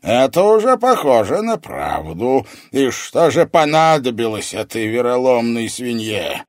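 imperious evil sorcerer; intrigued sorcerer. A narrator's reading. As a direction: probe with scornful interest.

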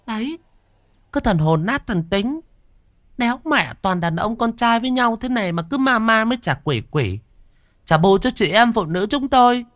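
very high-pitched mocking nag; strident scolding wife. A narrator's reading. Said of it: "cất cái giọng choa choa".

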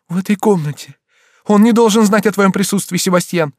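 high, urgent, alarmed exclamation; panicked urgency. A narrator's reading. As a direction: hustle the child away in alarm. Countess panics, hides her son. (в панике, торопя)

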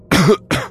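sharp loud coughing; sudden choking cough; smoke cough. A narrator's reading. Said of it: "закашлялся от дыма".

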